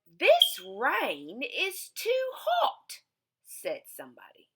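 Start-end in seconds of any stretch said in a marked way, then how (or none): 0.1-3.0 high, slow fussy voice; storybook visitor. Acted. Storybook visitor fussing about the rain, high and slow. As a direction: stretch out the complaint.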